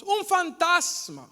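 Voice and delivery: high, loud, slow cry of terror; frightened shout. Acted. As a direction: scream it out as if seeing a ghost. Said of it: "gritaron a gran voz".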